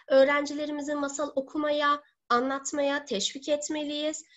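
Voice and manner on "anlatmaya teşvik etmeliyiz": usual speaking voice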